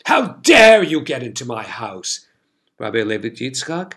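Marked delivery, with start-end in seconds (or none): 0.1-2.2 loud, indignant outburst, punching DARE; roaring in outrage as the Duke; outraged roar. A narrator's reading. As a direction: thunder at the intruders. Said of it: "he roared".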